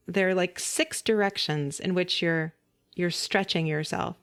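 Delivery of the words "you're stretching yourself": normal talking voice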